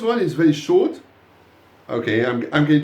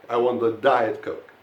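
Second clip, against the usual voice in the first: Diet stands out, Coke falls away; stress on Diet; 4 semitones lower than usual. Shown as a demonstration. Teacher insists on that particular drink, stressing Diet. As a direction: lean firmly on Diet. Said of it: "So you stress the word diet".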